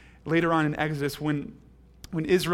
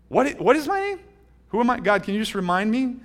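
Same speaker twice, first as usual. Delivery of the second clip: higher, mock befuddled amnesiac Moses; mock confused Moses; 7 semitones higher, 5 dB louder than usual. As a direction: play a forgetful Moses asking his own name. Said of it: "he's gotten amnesia".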